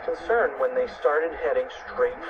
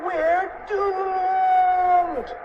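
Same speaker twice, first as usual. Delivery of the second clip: high, long-held wail of doom; shouted despair; 4 dB louder than usual. A narrator's reading. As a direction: wail it out, stretching the last word. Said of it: "shouted Cheeseball".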